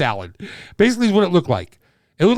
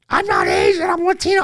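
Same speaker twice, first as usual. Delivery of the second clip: high, whiny mock protest, pitched way up; mocking offended voice; 4 dB louder than usual. Comic mocks a touchy stranger, high whiny protest. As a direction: play a touchy stranger correcting you.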